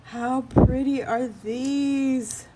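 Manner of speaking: high, slow gushing coo; cooing delight. Real, not acted. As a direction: swoon over each piece slowly. Gushing over resin bunnies.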